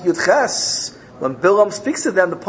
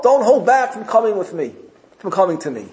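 haughty messengers; 4 semitones higher than usual. Acted. Messengers arrogantly ordering Bilam to come to Balak, haughty. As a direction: announce it like a proud envoy. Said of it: "they spoke to Bilam with Gaiva".

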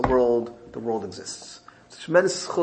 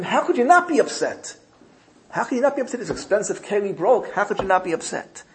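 upset wife; 8 semitones higher than usual. Retold or imitated. Wife protests the broken vessel, in a high upset voice.

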